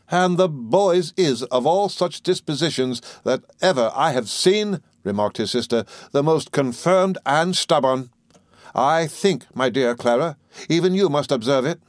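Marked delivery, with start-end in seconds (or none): 0.1-4.8 severe sister passing judgement, pitched high; stern Miss Murdstone. A narrator's reading. 6.1-11.8 severe sister passing judgement, pitched high; stern Miss Murdstone. A narrator's reading.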